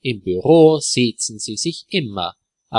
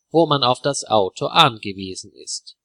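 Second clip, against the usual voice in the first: ordinary delivery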